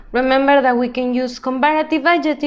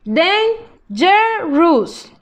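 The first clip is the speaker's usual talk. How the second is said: dangerous stretched out slowly, each syllable separate; slow modelling voice; 3 semitones higher, much slower than usual. Shown as a demonstration. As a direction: spell out dangerous slowly for the class. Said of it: "teacher models word then slows".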